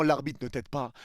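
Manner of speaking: ordinary talk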